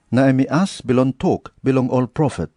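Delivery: usual speaking voice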